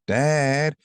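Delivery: high, slow tattling sister voice; squeaky high tattletale; mock little sister telling on him. Little sister telling Dad on her brother, tattling high. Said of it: "my sisters would say".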